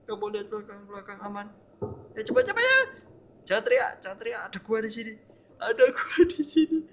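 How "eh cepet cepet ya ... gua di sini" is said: high, loud, frantic commands; panicked urging. Real, not acted. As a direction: hush and hurry a companion in panic. Panicked urging as danger nears.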